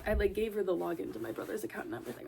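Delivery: ordinary talk, nothing marked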